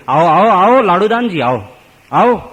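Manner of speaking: loud, high-pitched calls of greeting; acted hearty welcome. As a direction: call the honoured guest in eagerly. Maharaj stands to welcome the visitor.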